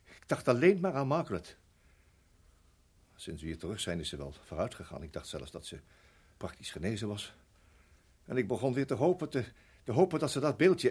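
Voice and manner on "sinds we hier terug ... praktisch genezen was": low, soft and weary; low sad murmur. Acted. Father's painful confession, dropping low.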